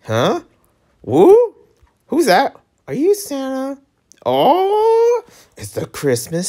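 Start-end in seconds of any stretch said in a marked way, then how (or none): 1.0-1.5 high, loud startled "woo"; surprised squeal. Real, not acted. Player startled by the game, squealing. 4.3-5.2 squeaky oh. Pretend. High put-on exclamation.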